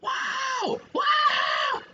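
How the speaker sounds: very high shouted exclamation; over-excited reaction. Pretend. Streamer making reactions for viewers, over-excited shout. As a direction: overdo the amazement, way up high. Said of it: "getting a little too excited".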